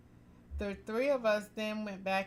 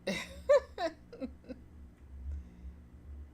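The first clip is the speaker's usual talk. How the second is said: airy giggling fit; high breathless laugh; 9 semitones higher than usual. Real, not acted. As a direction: burst out in helpless laughter. Reader bursts out laughing.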